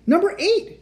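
high, loud shout. Acted. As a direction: shout it over the engine. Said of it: "shouted Jack".

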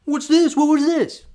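very high, loud incredulous squawk; hammed-up outraged linesman; mock indignant official. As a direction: act outraged at the tiny mistake.

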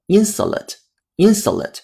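American "insulate" with a reduced "suh" middle, said slowly; American pronunciation. Shown as a demonstration. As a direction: slowly model the American "IN-suh-late", twice. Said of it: "这是美音".

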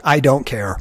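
loud, dismissive mock outburst; unempathetic manager. Tired manager to staff with problems, loud mock impatience. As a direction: imitate a fed-up boss brushing someone off, loudly. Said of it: "I'm not empathetic. I'm like".

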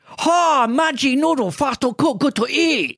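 very high, loud put-on voice; mocking ethnic caricature. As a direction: parody an ignorant stranger chanting an ad slogan. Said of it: "Your heritage is Thai".

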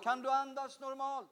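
very soft, far-off voice; distant relayed question. Retold or imitated. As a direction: ask it as if away from the phone. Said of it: "Hon frågade".

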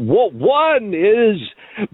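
one drawn out slowly and high; drawn-out build-up. Pretend. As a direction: stretch out one like a countdown. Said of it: "or as we like to say".

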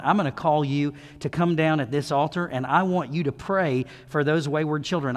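ordinary delivery

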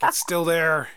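loud, high retold complaint; mock exasperated cry. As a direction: imitate a girlfriend wailing over an earworm. Acts out girlfriend pestered by earworm.

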